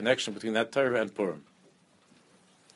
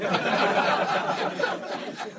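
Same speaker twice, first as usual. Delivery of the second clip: laugh. Real, not acted. Rabbi amused by his own story, laughing. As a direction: burst out laughing.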